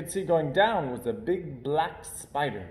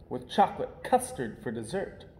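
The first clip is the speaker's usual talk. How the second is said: plain reading voice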